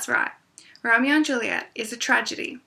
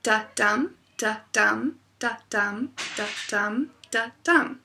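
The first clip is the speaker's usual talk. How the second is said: five "da-dum" feet, each "dum" stressed and rising; a steady run of "da-dum" with the stress on every "dum"; iambic pentameter beat; much slower than usual. Shown as a demonstration. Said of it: "Again, that is".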